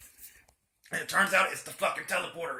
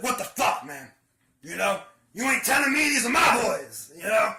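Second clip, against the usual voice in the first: angry confrontation; 4 semitones higher, 6 dB louder than usual. Retold or imitated.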